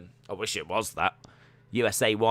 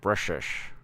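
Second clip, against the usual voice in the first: Scottish slurred "British"; a light Scottish Connery voice; Sean Connery impression; 3 semitones lower, 5 dB quieter than usual. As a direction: say "British" like Bond would. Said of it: "Sound like Sean Connery".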